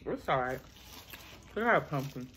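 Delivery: humming while chewing cake; muffled with food in mouth; mouth full, chewing. Real, not acted. Seen 0: Left speaker chews snack cake, bites again mid-clip, hums between bites.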